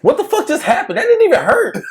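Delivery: can't believe it, half laughing; stunned laughing surprise; amazed disbelief. Retold or imitated. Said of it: "He was like".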